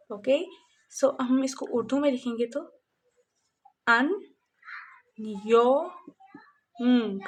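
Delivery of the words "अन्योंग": "अन्योंग" stretched very slowly, falling, with the final ng drawn out; stretched ending. Shown as a demonstration. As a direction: hold the ng of "अन्योंग" long at the end. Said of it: "अंग की आवाज़ आ रही है last में". ("अन्योंग" बहुत धीरे, अंत का ंग लंबा खींचकर)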